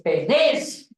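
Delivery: penés with the stress on its last syllable; stress on final E. Shown as a demonstration. Teacher correcting Silvia on the Greek word, stress on the last E of penés. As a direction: land the accent on the second E of penés. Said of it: "es con acento en la E".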